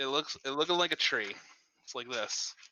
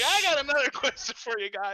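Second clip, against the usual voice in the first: laughing mock impression; 12 semitones higher, 7 dB louder than usual. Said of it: "And you're gonna be like".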